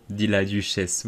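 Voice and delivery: ordinary delivery, nothing marked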